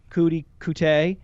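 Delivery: Coutee as KOO-tee, then koo-TAY; alternating pronunciations. Shown as a demonstration. Wavering between the name's pronunciations.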